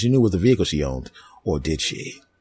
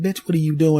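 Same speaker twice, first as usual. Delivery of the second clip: put-on sassy new girlfriend; 10 semitones higher than usual. New girl confronts ex.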